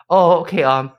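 halting broken English, somewhat high. Retold or imitated. Song Joong-ki in a date skit suggesting meat in broken English. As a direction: fumble from Korean to English. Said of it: "talking like that".

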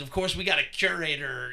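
usual speaking voice

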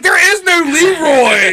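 loud, slow, high heel-wrestler rant; wrestling promo. Retold or imitated. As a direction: call out Leroy like a wrestler on the mic. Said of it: "Like a wrestling promo".

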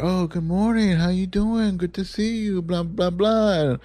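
high, soft sing-song niceties; mock pleasantries. Couple greeting the leasing office, high mock-polite. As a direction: coo the small talk, trailing into blahs.

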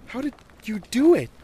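put-on high-pitched, loud child's question; astonished little girl; amazed young girl. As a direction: ask in wide-eyed wonder. Amazed girl asks her friend.

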